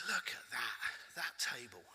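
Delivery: hushed low aside; mock confidential whisper. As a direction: lean in and whisper it.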